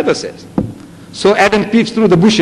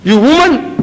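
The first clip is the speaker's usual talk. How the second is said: higher, louder accusing cry; accusing blame; 8 semitones higher, 4 dB louder than usual. Acted. Adam accusing the woman, louder and higher. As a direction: point the finger and blame her.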